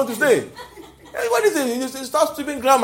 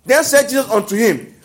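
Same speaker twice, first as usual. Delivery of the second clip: slow, weighty Bible reading; scripture reading; 7 dB louder, slower than usual. Acted. Preacher reading scripture to the congregation, slow and solemn. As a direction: read the verse slowly and solemnly.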